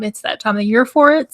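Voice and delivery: ordinary delivery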